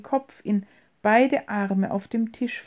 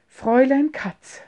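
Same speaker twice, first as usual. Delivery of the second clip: higher voice, struggling to stay composed; barely collected; laboured composure; 4 semitones higher, 4 dB louder than usual. A narrator's reading. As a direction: address her with composure held by effort. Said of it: "sprach er mit mühsam gefasster Stimme".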